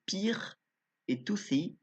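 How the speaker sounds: "Toothy" said with an English accent, TH as in English. Shown as a demonstration. Naming the partner card Toothy, in an English accent. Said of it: "bon accent anglais".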